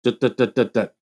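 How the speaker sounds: imitating choppy Rust Belt Polish-American speech; wordless Rust Belt accent beat. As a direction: mimic the Rust Belt accent's staccato rhythm. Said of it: "So they talked like this".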